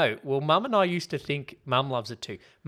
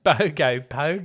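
louder mock Aussie echo; mimicked Aussie accent; 3 semitones higher, 6 dB louder than usual. Co-host echoes the dance name, mock Aussie accent. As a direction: exaggerate the Australian vowels. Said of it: "The way they say it".

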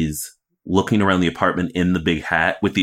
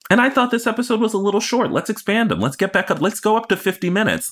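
much higher, fast excited demands; excited high gush. Real, not acted. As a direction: push eagerly, half playful. Playful demand for longer episodes.

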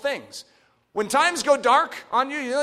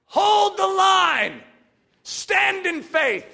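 slow, loud rallying call, pitched high; rally the troops with slow commands; preaching at full force; 9 semitones higher, 4 dB louder, much slower than usual. Acted. Preacher rallying his congregation, slow and loud.

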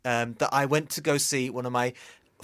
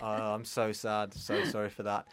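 sarcastic; 5 semitones lower, 7 dB quieter than usual. Acted. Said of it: "now you're being sarcastic".

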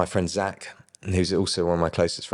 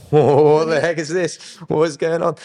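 reliving the shock of the leg changing; retold astonishment; 10 semitones higher, 7 dB louder than usual. Retelling the sudden healing.

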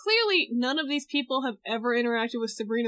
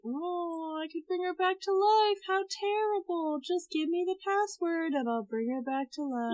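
high, soft tempting voice; the demon tempts Zelda in a soft, high, sly voice; mock sly demon; 5 semitones higher, 5 dB quieter than usual. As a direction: purr the offer softly up high.

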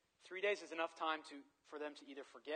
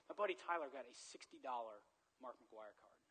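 ordinary delivery

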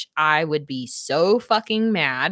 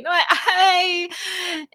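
laughing refusal; 11 semitones higher, much slower than usual. Retold or imitated. Hero voiced refusing, laughing.

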